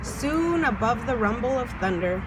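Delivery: usual speaking voice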